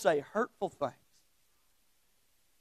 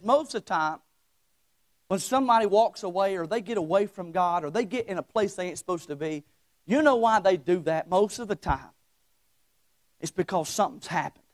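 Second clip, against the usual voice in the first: usual speaking voice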